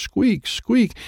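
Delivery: very high tiny squeaks; baby dragon squeaks. Acted. Narrator voicing baby dragons squeaking, very high.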